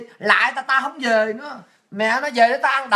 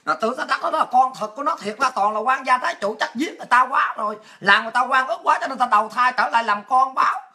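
ordinary talk, nothing marked

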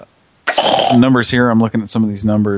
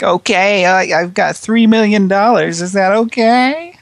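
much higher, louder, gullible fool voice; high mock sucker voice; 13 semitones higher, 4 dB louder, slower than usual. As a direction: play a naive mark, high and slow at the end. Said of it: "Just be like".

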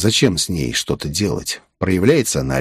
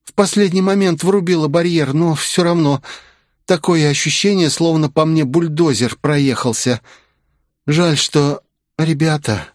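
put-on higher, weary young woman's voice; injured young woman; 7 semitones higher than usual. A narrator's reading. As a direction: speak like a hurt girl forcing words out.

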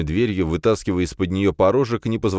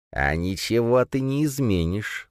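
put-on slow, higher, creaky old voice; creaky little voice; 6 semitones higher, slower than usual. A narrator's reading. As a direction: creak it out of the dark. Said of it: "услышал он тихий скрипящий голосок". (тихий скрипучий голосок)

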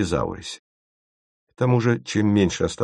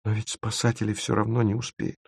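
hopeless; 3 semitones higher, 6 dB quieter than usual. A narrator's reading. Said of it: "безнадёжным тоном".

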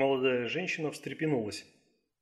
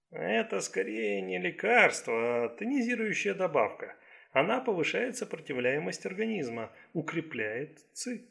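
higher, deliberate doctor's voice; Chinese doctor, explaining; 5 semitones higher than usual. Acted.

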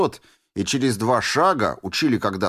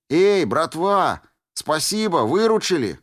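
calling out; 6 semitones higher than usual. Acted. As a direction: hail the lads from afar. Calling out to the stalkers.

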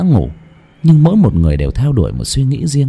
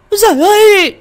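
louder, even higher shriek of terror; terrified scream; 5 dB louder than usual. A narrator's reading. Woman sees ghost by window, louder panicked shriek. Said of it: "vừa kêu thét lên".

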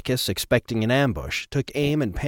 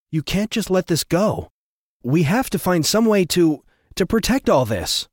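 upset plea; 8 semitones higher, 4 dB louder than usual. A narrator's reading. Andrea pleads, upset at Atticus.

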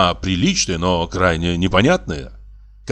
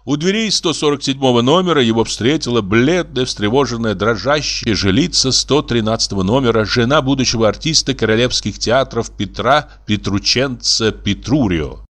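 ordinary delivery

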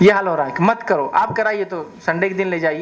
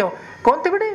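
higher, playful fake-innocent question to a child; mock innocent parent; 10 semitones higher, faster than usual. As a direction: pretend you don't know who shouted.

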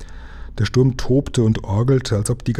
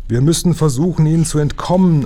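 high loud urgent call; urgent shout; 6 semitones higher, 4 dB louder than usual. Acted. Lukas telling the captain they must escape, loud and high. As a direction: shout it over the cannon fire.

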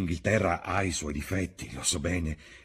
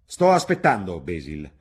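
clear and hard; 2 semitones higher, 9 dB louder than usual. A narrator's reading. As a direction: demand it, hard and impatient. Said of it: "con voce chiara e dura".

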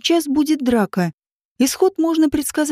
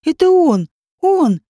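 high, louder cry; alarmed shout; 5 semitones higher, 4 dB louder, slower than usual. A narrator's reading. Валя points out the man, high alarmed shout. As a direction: cry it out in recognition. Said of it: "закричала Валя".

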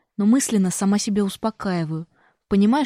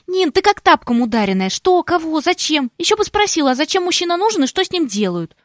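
much higher, louder exasperated scolding; exasperated sister; 9 semitones higher, 5 dB louder than usual. A narrator's reading. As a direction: scold her in disbelief. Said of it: "Цокает, тяжело вздыхая".

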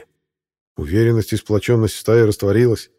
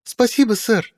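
put-on much higher, bright girl's thanks; cheerful girl; 13 semitones higher than usual. A narrator's reading. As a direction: say it gladly like a young girl. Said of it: "весело сказала Медоуларк".

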